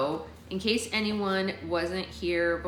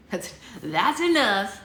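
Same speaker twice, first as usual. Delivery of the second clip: mock stern; 4 semitones higher, 6 dB louder, slower than usual. Real, not acted. Telling off her sneezes.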